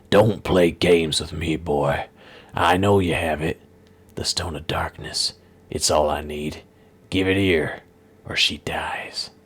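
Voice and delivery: low, slow threats sinking to a whisper; menacing villain. Acted. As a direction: hiss the demand with a knife ready. Villain demands the stone.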